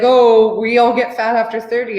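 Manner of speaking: loud, mocking parody of family; mock the family's old belief. Mocking family who say everyone gets fat, loud parody. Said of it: "they're like".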